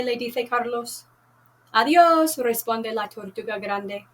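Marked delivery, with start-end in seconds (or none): 1.7-2.4 cheerful goodbye. Acted. Turtle voiced saying goodbye.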